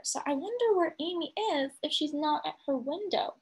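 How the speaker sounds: ordinary delivery, nothing marked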